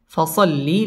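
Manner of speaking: normal talking voice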